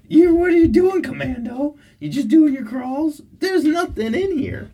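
high, loud teasing coo. Real, not acted. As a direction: tease the pup way up high. Teasing the dog.

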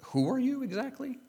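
high, rising disbelief; incredulous question. Acted. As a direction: ask it high, as if you can't believe it. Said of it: "I have to think the intonation is more like".

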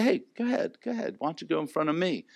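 a soft courteous let-you-go-first; gentle polite offer. Pretend. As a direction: hush down and step aside for someone.

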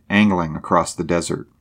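ordinary delivery, nothing marked

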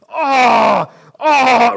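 loud hammed-up moans of pain; pained moaning. Preacher acting a poked eye, loud mock moan. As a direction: groan out like the eye really hurts.

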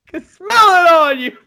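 high, loud, strained accusing cry; hammed-up Junior sniffing out a liar; mock accusing yell. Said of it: "He's like".

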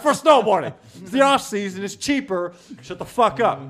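high, loud exasperated comeback; indignant retort. Retold or imitated. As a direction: snap back at the doubters, loud and high. Defending jacket to mocking friends.

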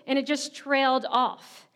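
ordinary delivery

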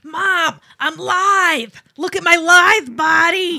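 high, loud hammy holler; mock attention-seeking kid yelling; daughter yelling. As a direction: show off at full volume for Mom.

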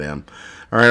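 ordinary talk, nothing marked

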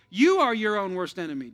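usual speaking voice

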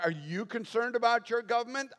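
high, rising challenge; mock challenging question. Imagined skeptic quizzing the preacher on government, mock challenging question.

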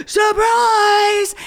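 high, shouted surprise; a retold high shout of surprise; mock surprise shout. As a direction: yell it like springing a surprise. Retold surprise party reveal.

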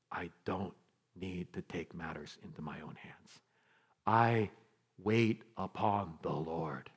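hushed, low, with long pauses; quiet realisation. Acted. David realising he can leave it to God, hushed. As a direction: let it land slowly and softly.

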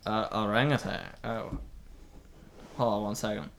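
low, deep male voice on radio; Fredericks voice. Acted. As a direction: drop low as Fredericks on walkie talkie. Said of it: "I have his voice".

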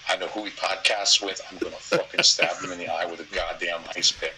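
normal talking voice

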